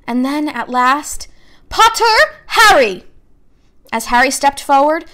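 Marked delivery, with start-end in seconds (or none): 1.7-3.0 calling out a name. A narrator's reading. Name called out at sorting.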